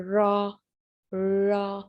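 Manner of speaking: रॉ, tongue bent back, R held; R sound demo. Shown as a demonstration. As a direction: repeat रॉ twice with a clear R. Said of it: "इस तरह की आपकी position है".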